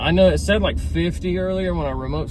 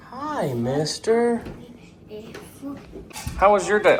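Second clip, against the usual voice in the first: slow, higher sweet greeting; tender greeting; 6 semitones higher, slower than usual. Real, not acted. Greeting his child at home, slow and sweet. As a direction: greet the little one gently.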